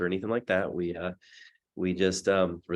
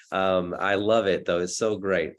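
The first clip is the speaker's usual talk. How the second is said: ordinary talk, nothing marked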